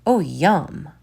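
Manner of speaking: ordinary delivery, nothing marked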